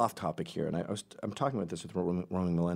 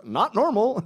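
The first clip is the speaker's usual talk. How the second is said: mock aside; 12 semitones higher, 8 dB louder, slower than usual. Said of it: "leans over the other one and goes".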